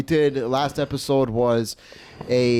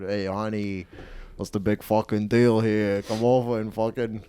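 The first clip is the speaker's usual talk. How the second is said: put-on crude New Jersey Italian-American accent; 3 semitones lower than usual. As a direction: talk like a Jersey Italian wise guy. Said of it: "I love that New Jersey part".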